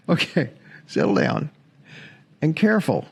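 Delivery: ordinary delivery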